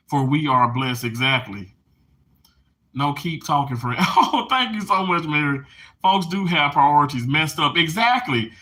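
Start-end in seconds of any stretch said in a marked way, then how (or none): none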